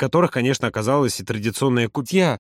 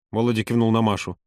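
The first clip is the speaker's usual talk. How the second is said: ordinary delivery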